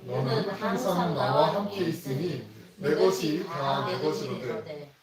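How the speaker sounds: loud, slow scripture read together; slow unison reading. Shown as a demonstration. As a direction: read the verse aloud with the congregation, slowly. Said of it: "같이 한번 읽어보겠습니다".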